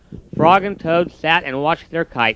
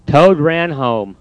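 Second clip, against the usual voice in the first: ordinary delivery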